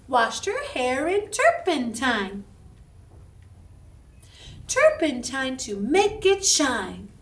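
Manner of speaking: loud, slow chanted rhyme; speaking voice rhyme. Shown as a demonstration. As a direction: chant the rhyme for kids to echo. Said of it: "I'll go first, and then you echo me".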